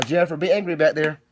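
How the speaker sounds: ordinary delivery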